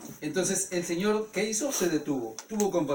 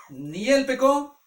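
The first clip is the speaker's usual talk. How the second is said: slow, loud, high firm answer; emphatic reply; 7 semitones higher, 8 dB louder, much slower than usual. Acted. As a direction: lay each word down slowly with authority. Jesus answers the Pharisees.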